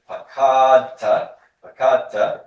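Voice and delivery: "Pakata" with a long, high, loud middle "ka"; accent on middle syllable. Shown as a demonstration. Teacher pointing out the accent to students, stressed middle of "Pakata". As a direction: lean hard on the second syllable of "Pakata", then drop. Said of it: "Notice the accent here".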